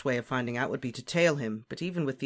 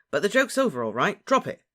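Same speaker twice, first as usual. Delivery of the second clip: loud, high-pitched telling-off; impatient; 7 semitones higher, 6 dB louder than usual. A narrator's reading. Harry ends friend's supposed joke, impatient. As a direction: order him to stop, fed up. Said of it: "said Harry impatiently".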